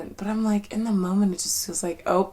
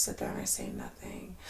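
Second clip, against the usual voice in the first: low mumble; 15 dB quieter than usual. Retold or imitated. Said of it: "But I'm just like".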